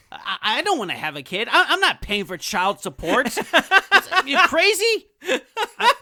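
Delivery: mock panic. Mock panic about child support.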